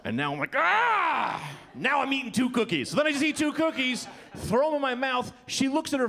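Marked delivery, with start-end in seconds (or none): 0.6-1.1 high, loud mock wail; defiant yell. Man offended over cookies, defiant yell. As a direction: let out a comic high "aah". Comic outburst after the insult.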